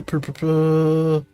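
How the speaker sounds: ordinary talk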